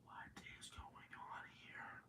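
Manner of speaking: slow hushed whisper. Acted. Narrator reacting to the mystery bang, slow eerie whisper. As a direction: breathe it out slowly like something creepy is near. Spooky aside after the story.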